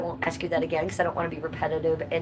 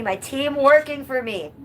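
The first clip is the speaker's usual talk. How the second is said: proud cheer; 6 semitones higher, 4 dB louder, slower than usual. Shown as a demonstration.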